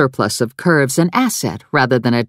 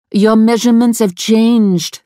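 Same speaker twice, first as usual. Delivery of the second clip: higher, louder accusing French seamstress; accusing Frenchwoman; 8 semitones higher, 4 dB louder than usual. A narrator's reading. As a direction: accuse her as a stern French dressmaker. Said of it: "the Frenchwoman accused".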